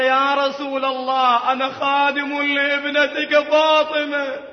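retold plea in a slow, sustained cry; impassioned plea. Servant pleads to the Prophet for recognition, impassioned.

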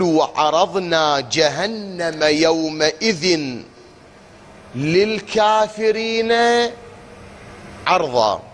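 slow, melodic Quranic chanting; slow chanted recitation with a near-sung للكافرين. Acted. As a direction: draw the verse out in tajwid style. Reciting Quran verse slowly.

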